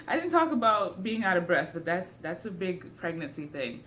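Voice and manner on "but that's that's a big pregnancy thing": ordinary delivery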